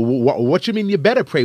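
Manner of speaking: ordinary talk, nothing marked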